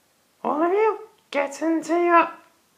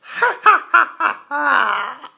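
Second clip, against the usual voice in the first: evil laugh; 6 dB louder than usual. Acted. Halloween host evil laugh.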